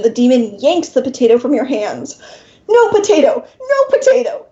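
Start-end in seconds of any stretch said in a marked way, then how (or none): 2.7-4.5 high, shrill-edged shouting snarl, climbing; the demon's tantrum boils over. Acted. Demon snarls over the potato, high and furious. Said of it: "he snarls".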